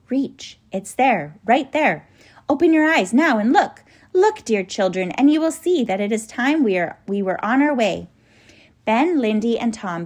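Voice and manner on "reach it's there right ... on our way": higher, louder urging; urgent, rising excitement. A narrator's reading. Professor urging children to open their eyes, excited. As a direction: build to an excited call.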